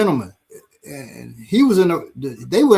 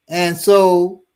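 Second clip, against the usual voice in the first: ordinary talk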